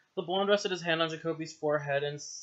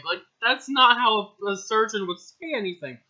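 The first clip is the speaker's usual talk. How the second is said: ordinary delivery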